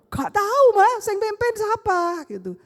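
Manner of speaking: ordinary talk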